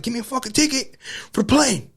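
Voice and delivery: high-pitched exasperated demand; retold pissed-off pleading. Son demanding a plane ticket from mom, retold pissed-off outburst. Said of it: "Like, I was pissed".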